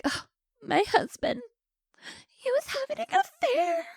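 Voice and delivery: high, plaintive mock wail; hammed-up distraught widow. Acting out the grieving widow.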